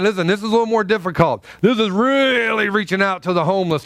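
ordinary talk

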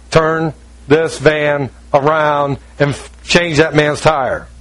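slow, spaced-out divine order; deliberate command. Retold or imitated. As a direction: lay down each word slowly, with authority. God's command, spaced out, retold.

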